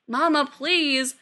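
loud, drawn-out plea; beg, stretching the words; pleading. A narrator's reading. Son begging his mother not to come, pleading.

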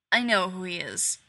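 short, curt and loud; terse. Acted. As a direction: clip it off, no patience. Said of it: "voice terse".

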